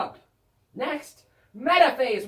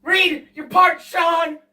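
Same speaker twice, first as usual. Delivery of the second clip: higher, louder furious demand; angry shout; 5 semitones higher, 9 dB louder than usual. Acted. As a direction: bark the order in mock fury. Demanding partner reads.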